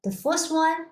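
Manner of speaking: normal talking voice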